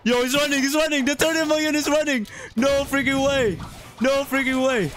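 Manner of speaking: high-pitched, giddy excitement; thrilled disbelief. Real, not acted. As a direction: squeal in amazement, laughter breaking in. Top player is running away.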